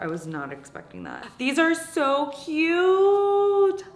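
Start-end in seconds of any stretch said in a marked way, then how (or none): 1.4-3.8 high, drawn-out squeal of delight. Real, not acted. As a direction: squeal it like it's adorable. Squealing over cute decorations.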